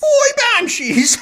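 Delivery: high laughing disbelief; mocking laugh. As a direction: laugh at the silly question.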